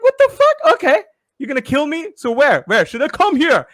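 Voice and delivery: high, loud, incredulous retort; mock disbelief. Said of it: "I'm like". (retelling his own baffled reply, pitched way up)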